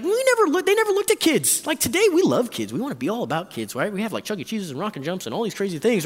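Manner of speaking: ordinary talk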